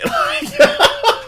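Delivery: loud high-pitched laugh. Real, not acted. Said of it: "laughs at own voice".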